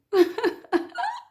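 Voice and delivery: a loud, high laugh that breaks into a cry; laughing through tears. Real, not acted. Cook laughing at her onion tears, loud high laugh-cry. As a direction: laugh helplessly at your own onion tears. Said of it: "And also, I'm crying".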